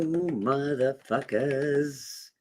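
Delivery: very slow, stretched-out rallying call; long-held, playful hype cry; drawn-out hype. Pretend. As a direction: stretch every word like a slow-motion cheer.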